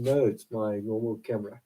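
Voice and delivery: usual speaking voice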